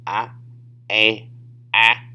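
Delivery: "ah", then long "a" as in day, then flat "ah" as in cat; varied English A sounds. Shown as a demonstration. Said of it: "In English, it could be".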